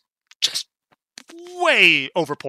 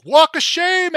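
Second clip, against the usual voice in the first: higher, louder jeering call; mocking yell; 14 semitones higher, 6 dB louder, slower than usual. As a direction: shout it across the street like a heckler. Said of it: "who are just yelling".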